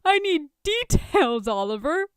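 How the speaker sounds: high, laughing demand for details; breathless from laughing. A narrator's reading. Tony breathless with laughter, begging Oliver for gossip. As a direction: gasp it out mid-laugh. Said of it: "Tony gasps, trying to breathe".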